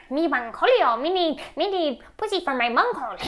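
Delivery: high, loud cartoon-teen voice with a laugh; Beavis and Butthead. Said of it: "it's like Beavis and Butthead".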